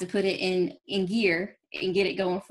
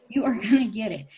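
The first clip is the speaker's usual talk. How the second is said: normal talking voice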